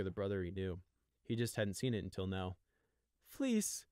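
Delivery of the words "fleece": pleading. Acted. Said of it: "in a bid to appeal".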